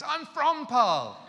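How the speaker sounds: very high, loud, drawn-out excitement; ecstatic exclamation. Retold or imitated. Secretary discovers the trip goes to her town, ecstatic. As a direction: cry it out in amazed delight.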